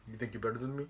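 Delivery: low, very soft tough-guy mumble; understated, deadened challenge; Roman Reigns. Retold or imitated. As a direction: imitate a wrestler with no mic skills. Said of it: "and of course he says".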